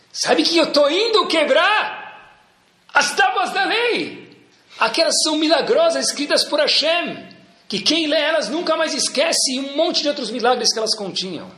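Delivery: high, slow, grand announcement; Moshe Rabbeinu proclaiming. Acted. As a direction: proclaim it high and drawn out like a prophet. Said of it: "Veio Moshe Rabbeinu escreve isso na Torá".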